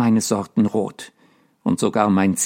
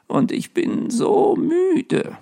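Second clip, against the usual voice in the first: much higher and slow, tired ghost; high, slow, drooping old ghost; weary ghost; slower than usual. A narrator's reading. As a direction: drag it out, exhausted. Ghost sighs his tiredness.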